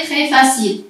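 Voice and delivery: normal talking voice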